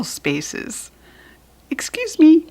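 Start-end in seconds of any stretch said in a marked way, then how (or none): 1.7-2.5 higher, louder and quick, like a small polite cloud; polite little voice. Acted. Small cloud slipping past the birds, high polite voice. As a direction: pipe up a tiny excuse me.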